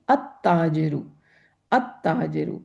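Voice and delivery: अत-ताजरु with no l sound and a doubled t; teacher modelling अत-ताजरु, lam silent; sun letter assimilation. Shown as a demonstration. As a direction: say अत-ताजरु as at-tajiru, the lam dropped. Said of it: "अल-ताजरु is not sounding correct".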